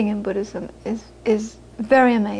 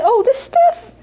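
much higher and louder, breezy surprise; mock surprised mother; 12 semitones higher, 4 dB louder, slower than usual. Mother answering the daughter's friends, high mock surprise. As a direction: toss it off like it's nothing.

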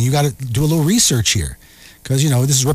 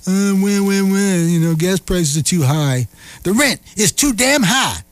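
whiny complaining voice, higher; mock whining; 5 semitones higher than usual. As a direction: whine like a bar complainer. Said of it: "bitching and moaning".

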